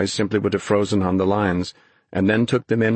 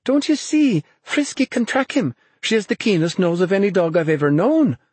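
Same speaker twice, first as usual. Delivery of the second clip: high, eager insistence; excited cry; 11 semitones higher than usual. A narrator's reading. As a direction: urge him excitedly, voice pitched way up. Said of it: "she cried".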